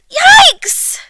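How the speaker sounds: high, loud shriek of alarm; mother's yell. A narrator's reading. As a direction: yelp in shock. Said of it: "yelled her mother".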